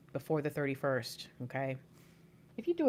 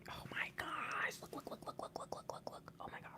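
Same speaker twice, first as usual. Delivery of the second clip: soft, rapid whispered excitement; excited whisper; 15 dB quieter than usual. Real, not acted. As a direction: whisper it, too thrilled to speak aloud. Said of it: "I'm freaking excited right now".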